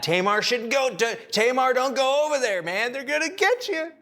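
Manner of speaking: high, pleading don't go over there; preacher pretending to shout to Tamar; mock warning cry. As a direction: call out to her like a horror film viewer. Warning the story character aloud.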